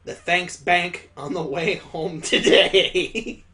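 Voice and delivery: slow reading breaking into laughter; laughing while reading. Real, not acted. Reader cracking up at Daddy's lame joke, laughing. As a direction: crack up partway through the line.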